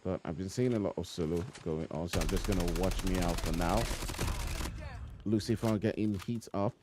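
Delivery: brisk esports caster commentary, running call of the action; live play-by-play. Acted. Caster calling the match for stream viewers, brisk play-by-play. Casting a battle royale match.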